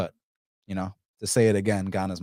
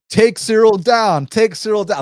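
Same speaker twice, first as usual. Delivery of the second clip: fans urging him; 11 semitones higher, 9 dB louder than usual. Retold or imitated. Fans telling him to take Cyril down, loud chant. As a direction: call it out like a crowd of fans egging him on.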